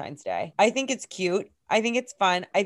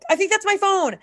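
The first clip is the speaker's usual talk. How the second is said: high excited call; 12 semitones higher than usual. Retold or imitated. Calling to strangers about phone.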